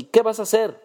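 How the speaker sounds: loud, high cry of thief; furious shout. A narrator's reading. Servant defending the girl from a stranger, loud furious shout. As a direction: yell it beside yourself. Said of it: "gritó fuera de sí".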